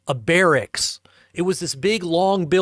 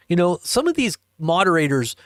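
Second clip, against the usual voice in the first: ordinary talk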